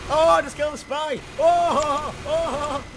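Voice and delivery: high, loud gloating crowing. Real, not acted. Player boasting of killing the spy, gloating. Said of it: "that was a bit gloatish".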